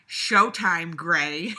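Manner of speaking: camp it up like a cartoon villain; over-the-top mock villain with a cackling laugh; hammed-up villain.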